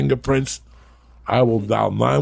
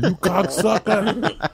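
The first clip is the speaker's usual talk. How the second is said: high, slow mock yell through a megaphone; 10 semitones higher, slower than usual. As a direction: bellow it like through a bullhorn. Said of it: "With the megaphone".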